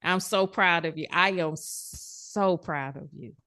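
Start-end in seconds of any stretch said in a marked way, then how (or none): 1.1-3.3 softer, slower, lower praise; tender. Real, not acted. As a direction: let the pride settle gently. Coach praising client after sharing.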